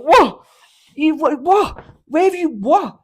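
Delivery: very high, loud baffled shouting; hammed-up bewildered lads; mates yelling. The boys reacting to his sleep-talk, loud baffled shouts. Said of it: "the boys are going".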